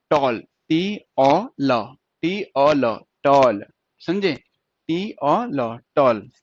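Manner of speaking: very slow letter by letter, T O L; slow spelling out. Shown as a demonstration. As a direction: spell टॉल letter by letter for students. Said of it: "teacher spells the word aloud". (धीरे धीरे अक्षर अलग अलग बोलना)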